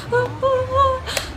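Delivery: high-pitched whimpering "oh, oh, oh"; mock-flustered little squeals; high fangirl whimper. Sees the dancers turn back around.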